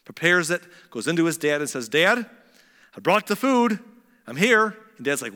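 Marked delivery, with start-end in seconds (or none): 1.9-4.8 high, loud announcement to Dad; eager returning son. Acted. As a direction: call out proudly, acting the son. Esau arrives eager with his food.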